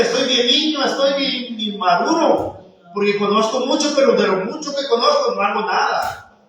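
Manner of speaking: normal talking voice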